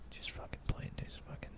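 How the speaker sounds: imitated angry whisper, building slightly; seething whisper. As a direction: whisper it as the furious friend in back. Seething friend in the back seat.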